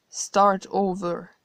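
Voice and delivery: slow, matter-of-fact statement; calm confirmation. Acted. God coolly confirming to the narrator, slow and matter-of-fact. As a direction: spell it out slowly and plainly.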